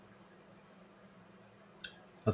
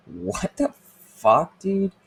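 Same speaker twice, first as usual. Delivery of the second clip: incredulous; 11 semitones higher, 4 dB louder, much slower than usual. Retold or imitated. Disgusted rant at TikTok kids.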